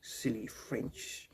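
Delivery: lightly put-on French accent, trailing off quiet; mock French accent. Mock French local scolding a tourist in French accent. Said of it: "the French accent volume up".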